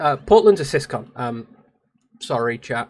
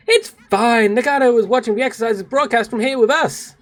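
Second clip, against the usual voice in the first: higher-pitched, reassuring heroine voice; cheerful game character; 7 semitones higher than usual. Acted. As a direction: reassure them brightly about Nagato.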